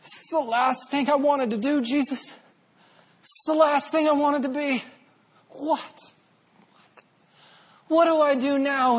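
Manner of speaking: high, pained cry to Jesus; grieving plea. Retold or imitated. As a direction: plead through grief, voice straining up. Retold prayer in grief.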